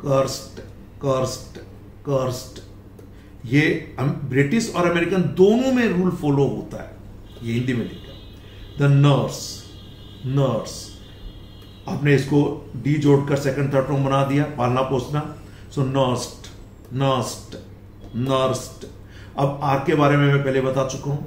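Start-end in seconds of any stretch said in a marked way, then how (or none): 0.1-2.6 cursed said as "curst"; cursed repeated, each closed on ट; final t sound. Shown as a demonstration. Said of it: "not de". 15.8-18.9 nursed said as "nurst"; nursed repeated, each ending in ट; final t sound. Shown as a demonstration. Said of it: "ईडी का pronunciation ट हो जाएगा".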